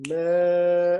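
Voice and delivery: "Men" held long and steady; held four beats. Shown as a demonstration. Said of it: "hold it for those four beats".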